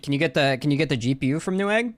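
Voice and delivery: ordinary talk, nothing marked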